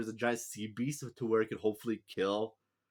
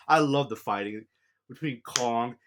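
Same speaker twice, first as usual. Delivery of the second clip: ordinary delivery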